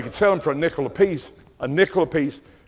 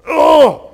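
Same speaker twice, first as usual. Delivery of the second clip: high loud oh; much higher, louder exclamation of dread; shocked gasp; 11 semitones higher, 11 dB louder than usual. Real, not acted. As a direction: cry out at the dreaded news. Reacting to the threat.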